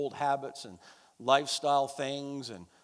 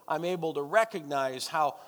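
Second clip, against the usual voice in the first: normal talking voice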